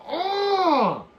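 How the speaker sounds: high, loud drawn-out cry; exaggerated outburst of dismay; long howl of "oh"; mock wailed "oh". Mock reaction to chat joke.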